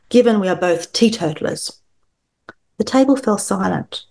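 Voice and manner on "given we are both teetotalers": ordinary talk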